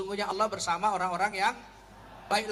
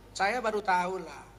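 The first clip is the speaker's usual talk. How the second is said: usual speaking voice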